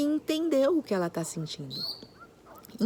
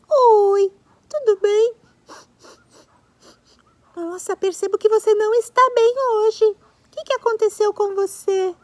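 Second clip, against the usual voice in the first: higher, louder, sweet puppet voice; giraffe friend; 11 semitones higher, 10 dB louder than usual. Pretend. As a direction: play the little giraffe cheerfully. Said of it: "a amiguinha dele, a girafinha".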